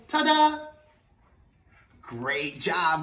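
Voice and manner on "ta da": cheery ta-da. Shown as a demonstration. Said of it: "Can you say".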